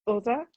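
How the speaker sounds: "other" with a short northern oo; Yorkshire "other". Shown as a demonstration. Repeating the picked-up Yorkshire habit, "other" said as "oother". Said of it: "I very frequently say other".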